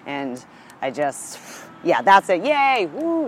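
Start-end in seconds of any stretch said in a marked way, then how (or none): 2.5-3.3 high-pitched yay and woo; celebratory cheer. Real, not acted. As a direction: sign off with a short, high whoop. Closing cheer for the signing.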